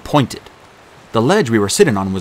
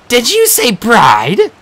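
much higher, louder, slow outrage; incredulous demand; 12 semitones higher, 7 dB louder, much slower than usual. A narrator's reading. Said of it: "Clarice demanded".

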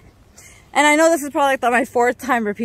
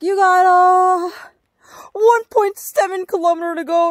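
lightly voiced cheerful hiker; imitating the passer-by; 8 semitones higher, 4 dB louder than usual. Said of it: "He's like".

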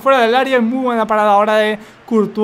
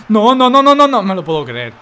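disbelief; 5 dB louder than usual. Real, not acted. Opponent scores equalizer.

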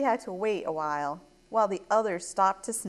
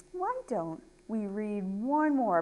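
ordinary delivery

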